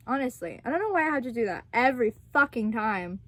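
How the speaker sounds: ordinary delivery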